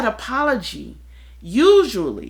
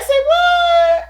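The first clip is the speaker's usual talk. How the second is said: squeaky high, louder disbelief; shocked "say what"; 8 dB louder than usual. Retold or imitated. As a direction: act floored. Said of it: "And I'd be like".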